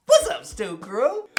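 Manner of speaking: slow, high hype welcome; excited greeting. Real, not acted. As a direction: draw out the channel greeting. Channel greeting shout-out.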